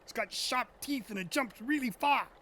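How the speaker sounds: high put-on British accent; hammed-up British movie-quote voice. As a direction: do your best British impression, pitched well up. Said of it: "That was my best British accent".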